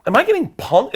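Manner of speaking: panicked thought. Retold or imitated. Comedian fearing a prank, high and loud panic. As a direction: squeak it out in sudden panic.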